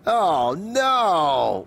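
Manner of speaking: loud dismayed wail. A narrator's reading. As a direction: wail it out in horror. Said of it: "wailed Fox".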